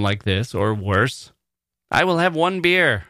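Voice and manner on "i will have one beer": higher, stiff, overcareful tourist voice; clumsy foreigner ordering. Retold or imitated. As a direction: order a beer like a nervous beginner. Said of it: "That's the kind of thing I say".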